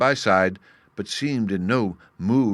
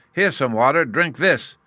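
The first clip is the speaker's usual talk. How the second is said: loud, higher mocking bellow; 6 semitones higher, 6 dB louder than usual. A narrator's reading. Bully boy drenching the beggar, loud bellow. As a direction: yell it cruelly. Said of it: "Uggug bellowed".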